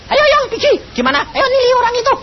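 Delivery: high, loud urgent wife; wife urging. Retold or imitated. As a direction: push him out the door, hurry. Wife urging him, retold. (istri mendesak dengan suara tinggi dan keras)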